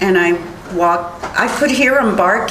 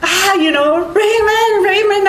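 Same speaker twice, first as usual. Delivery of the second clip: high-pitched cry for help, not full volume; retold panicked scream; 10 semitones higher than usual. Witness reliving her scream to her husband for help, high and panicked. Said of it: "I was screaming, and I was like".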